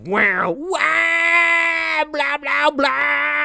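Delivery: very high, shouted, sarcastic cheer; mock hype shout. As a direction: squeal it like a bored cheerleader. Mocking the credits music.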